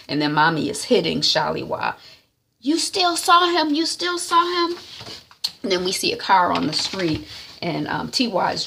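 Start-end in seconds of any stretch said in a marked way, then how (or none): 2.6-4.8 high, accusing repeated question; mock angry mother. Mum hitting Shaliwa, demanding high and sharp. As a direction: drill the question at her like a furious mum.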